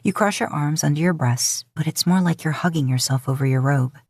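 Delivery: ordinary delivery, nothing marked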